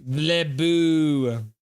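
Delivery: slow, drawn-out mock-French boo. Shown as a demonstration. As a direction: demonstrate a long French-style jeer. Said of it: "That's how you say it".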